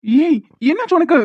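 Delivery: high, loud frantic questions; husband panicking about wife's fall and the baby, alarmed; panicked alarm. Acted. Husband panics over fallen wife.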